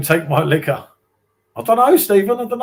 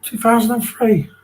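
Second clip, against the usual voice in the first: surprised; 7 semitones higher than usual. Real, not acted. Shocked at how long ago.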